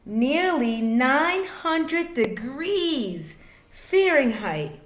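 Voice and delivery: lively, sing-song picture book reading; reading to children. A narrator's reading. Reading a rhyming space book to kids, sing-song. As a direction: bounce the rhymes for little listeners.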